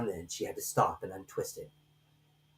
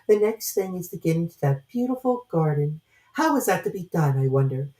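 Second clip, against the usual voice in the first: Alice musing aloud; 8 semitones higher, 8 dB louder than usual. A narrator's reading. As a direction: puzzle it out to herself. Alice talks to herself.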